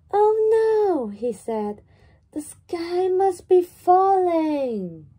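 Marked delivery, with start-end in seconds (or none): 0.1-1.1 high, loud cry of alarm; frightened chick. Acted. Chick panics at falling acorn. 2.3-5.1 high, loud and slow, full of alarm; frightened chick. Acted. Chick panics at falling acorn.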